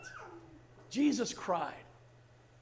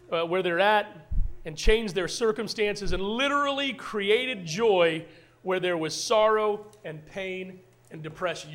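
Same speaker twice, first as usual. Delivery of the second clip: loud, driving sermon delivery; full-force preaching; 6 dB louder than usual. Acted. Pastor declaring God is the source of joy, full-force preaching. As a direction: preach it out to the room.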